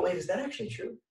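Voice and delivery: a sudden quiet, unsure aside; doubting yourself. Retold or imitated. Asking yourself mid-story whether it's true, quietly unsure. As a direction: pull back and question yourself softly.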